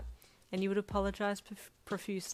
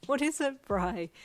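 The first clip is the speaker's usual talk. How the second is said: high, louder amused question; giggly question; 6 semitones higher, 6 dB louder than usual. A narrator's reading. Asking an excited friend for his news, giggly. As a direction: ask eagerly, on the edge of a giggle. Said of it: "you asked with a giggle".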